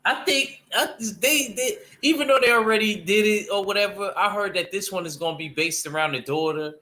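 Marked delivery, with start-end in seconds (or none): 0.0-5.5 excited. Real, not acted. Hyped about a new Blade film.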